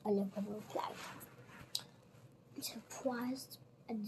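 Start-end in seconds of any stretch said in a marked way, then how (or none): none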